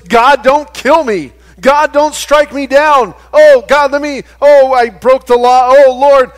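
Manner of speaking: loud, high mock terror before God; panicked pleading. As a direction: cry out as if begging to be spared, hammed-up. Acted terrified plea to God.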